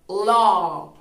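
law said loud with a long "aw" vowel, like "lo"; correct pronunciation. Shown as a demonstration. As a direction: model law as "lo". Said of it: "su pronunciación correcta sería".

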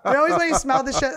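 words laughed through, loud and high; telling a gross story and cracking up; laughing. Real, not acted. Laughing at her own joke.

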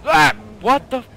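sudden frightened yell; startled shout. Real, not acted. Jump scare in horror game.

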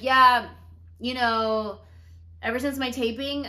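putting on excuse. Retold or imitated. Telling a friend a cover story, loudly put-on. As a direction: announce a made-up excuse, retold. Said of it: "make up this whole lie".

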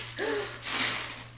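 quick high intake of breath; breathless gasp of surprise; sharp excited gasp. Real, not acted. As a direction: gasp in delight. Seeing belly plate.

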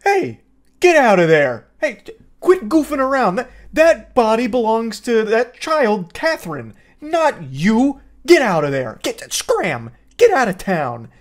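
loud, high scolding of a demon like a goofy mom. Retold or imitated. Mother shouting at the possessing demon to scram, mock stern. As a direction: yell at it to get out like shooing a pet. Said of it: "her strategy is".

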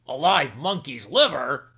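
king aghast at his wife's request; shocked exclamation. Acted. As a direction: repeat it in disbelief. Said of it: "exclaimed the king".

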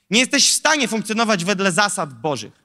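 normal talking voice

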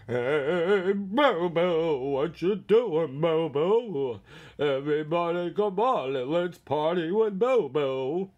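loud, swooping cartoon voice; put-on party character. Calling Bobo to party, loud goofy character voice. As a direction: call out like a rowdy cartoon buddy.

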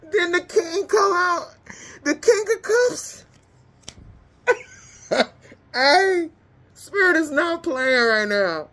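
high-pitched, giddy cackling delivery; real tickled excitement; laughing. Real, not acted. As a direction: squeal it between bursts of laughter. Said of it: "Hey, let me stop".

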